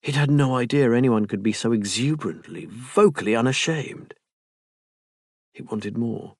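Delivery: usual speaking voice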